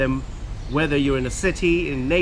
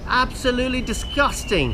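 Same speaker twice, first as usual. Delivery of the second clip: disgusted; 9 semitones higher than usual. Real, not acted. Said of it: "Sorry about the rant".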